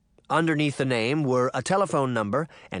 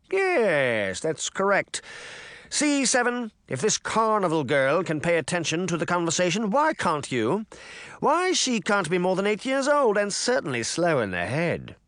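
high, pompous sneering voice; condescending mayor; 7 semitones higher than usual. A narrator's reading. Mayor belittling Sabrina, high and condescending. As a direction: talk down to a child.

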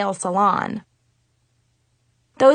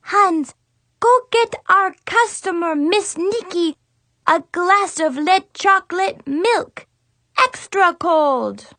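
put-on a kid ordering her teddy bear butler around; commanding fake French accent; 7 semitones higher than usual. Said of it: "she commanded".

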